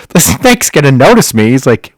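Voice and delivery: high, loud mock alarm; acted-out incredulous outburst; alarmed disbelief. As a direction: imitate someone appalled at the idea. Friend's alarmed disbelief, retold.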